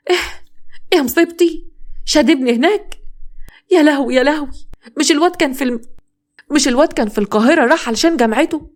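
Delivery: frantic mother wailing "يا لهوي"; panicked wailing. Acted. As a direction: cry out in terror for your son. Mother wails son in fire.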